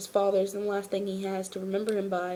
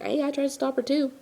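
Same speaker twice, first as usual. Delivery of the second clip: higher-pitched mock protest; defensive retort; 6 semitones higher than usual. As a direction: voice a character defending himself, pitched up. Voicing a character's defensive retort.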